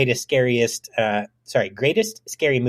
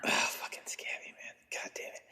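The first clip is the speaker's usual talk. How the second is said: very soft exasperated grumble; defeated whisper; whispered cursing; 19 dB quieter than usual. Acted. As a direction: swear under your breath. Cursing under breath.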